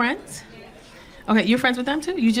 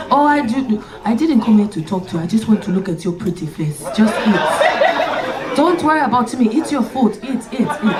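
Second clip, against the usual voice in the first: lower, coaxing mock date voice; imitating the date; 4 semitones lower than usual. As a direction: play the creepy admiring date, lightly voiced. Comic voices staring date.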